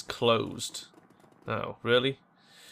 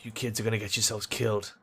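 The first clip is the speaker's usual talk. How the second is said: hard-bitten player character; 2 semitones lower, 4 dB quieter than usual. Acted. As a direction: warn the kids in a lowered voice.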